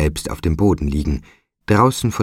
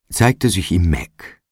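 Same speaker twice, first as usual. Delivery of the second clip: ordinary delivery, nothing marked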